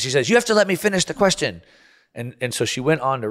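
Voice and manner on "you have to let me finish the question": impatient congresswoman. Retold or imitated. Congresswoman cutting off the witness.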